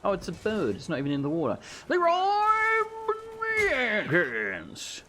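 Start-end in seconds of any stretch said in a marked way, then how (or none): 1.9-5.0 drawn-out gamer war cry; long playful charge yell; mock battle cry.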